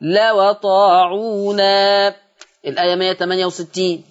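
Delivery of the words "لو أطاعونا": لو أطاعونا very slow, law-aṭāʿūnā with open waw; slow recitation; transferred vowel. Shown as a demonstration. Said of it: "هتبقى الواو مفتوحة".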